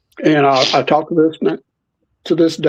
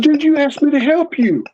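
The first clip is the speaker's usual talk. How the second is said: high-pitched mock indignation; retold protest; 10 semitones higher than usual. Blamed boy to his friend, indignant protest. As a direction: plead your case in a raised pitch.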